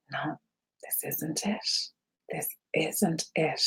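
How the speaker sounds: hushed inner voice. Retold or imitated. Founder recalling her doubts on her journey, hushed whisper. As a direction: whisper it as a private realisation.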